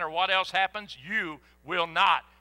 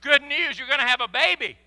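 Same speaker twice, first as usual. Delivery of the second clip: mock excited angel; 7 semitones higher, 9 dB louder than usual. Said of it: "the angel comes and says".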